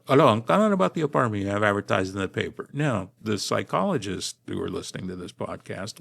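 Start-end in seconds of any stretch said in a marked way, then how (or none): 0.1-2.7 quick Chicano English accent, Spanish-tinged; fast Chicano-accented phone call. Shown as a demonstration. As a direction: rattle it off like a Chicano from LA. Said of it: "I sound like a Chicano if I want to".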